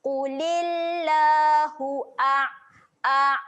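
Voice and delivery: slow recitation of "Qulillahu a-", the ain held; Quranic recitation. Shown as a demonstration. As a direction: hold the ain mati tight. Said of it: "ain mati kena tahan". (tahan huruf ain mati)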